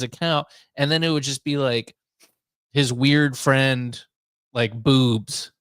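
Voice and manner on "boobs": usual speaking voice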